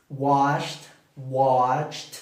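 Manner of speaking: washed and watched ending in a crisp T. Shown as a demonstration. Teacher demonstrating past endings with a T. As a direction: finish washed and watched on a clear T. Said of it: "Va a sonar con T al final".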